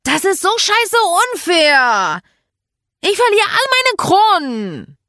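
high, loud, drawn-out whining shout; outraged wail. Acted. Child protesting over lost game crowns, outraged wail. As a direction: wail loudly at how unfair it all is.

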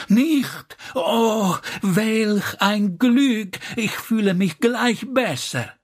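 high, theatrical gush of relief; effusive relief. A narrator's reading. Slavic lady hears there is no danger, effusively relieved.

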